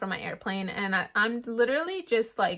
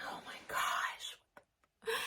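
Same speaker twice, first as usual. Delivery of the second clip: slow, breathless whisper of dread; panicked whisper; 14 dB quieter, much slower than usual. Retold or imitated. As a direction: whisper it like you've been caught. Nervous inner panic at checkpoint.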